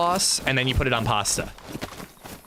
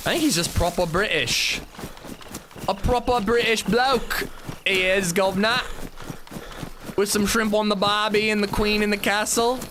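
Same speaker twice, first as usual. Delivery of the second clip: hammed-up British bloke accent drifting into mock Australian, higher pitched; 8 semitones higher than usual. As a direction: play a cheeky Brit who slides into Aussie. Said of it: "I have no idea what accent I'm doing anymore".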